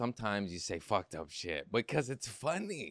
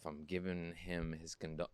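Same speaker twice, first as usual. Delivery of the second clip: ordinary talk, nothing marked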